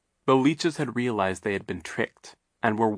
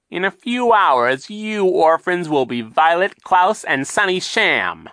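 high, loud, mocking villain voice; sly villain Captain Sham; 11 semitones higher, 8 dB louder than usual. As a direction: sneer it out like a scheming captain.

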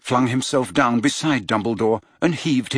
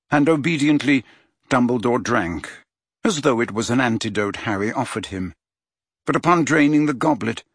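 plain reading voice

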